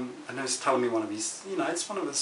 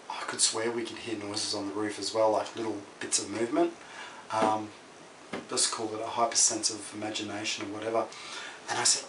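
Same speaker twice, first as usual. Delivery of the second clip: ordinary delivery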